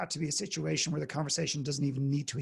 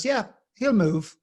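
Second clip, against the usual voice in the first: put-on slow, higher, lazy old-timer reply; unbothered old man; 8 semitones higher, 9 dB louder, slower than usual. Old John answering the visitor, slow and unbothered. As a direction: shrug it off, totally unbothered.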